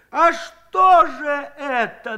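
high, slow, loud demand; pressing anger. A narrator's reading. Aniska presses Shchukar, high and angry. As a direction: push at him hard. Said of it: "наседала Аниська".

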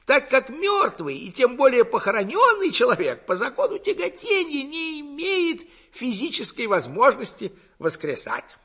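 high, loud, gurgling self-confidence; self-assured burbling lecturer. A narrator's reading. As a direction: lecture the villagers with cocky certainty in a high voice. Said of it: "продолжал самоуверенно".

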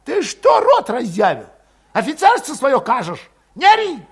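high, loud, furious yell; shouting back. A narrator's reading. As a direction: scream it at him, high. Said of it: "выкрикнул Валет".

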